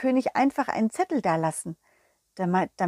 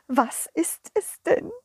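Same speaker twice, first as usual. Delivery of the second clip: slow whining plea; slow, whimpering king; whiny king; 4 semitones higher, slower than usual. Acted. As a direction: beg like a pouting king. King pleading.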